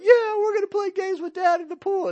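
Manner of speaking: put-on very high, loud excited child; excited kid voice. Dad voicing his kid to the congregation in a very high, loud excited squeal. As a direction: squeal like a thrilled kid going into a pool. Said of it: "the kid's like".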